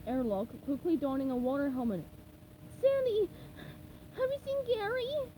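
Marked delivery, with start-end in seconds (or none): 2.8-5.3 high cheerful cartoon voice; SpongeBob voice. A narrator's reading. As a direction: chirp it, bright and high.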